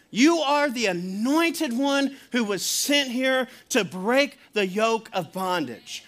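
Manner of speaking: Peter's revelation, drawn out and emphatic; voicing Peter. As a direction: lay it out slowly as Peter declaring who Jesus is. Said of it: "when Peter would say this, he's saying".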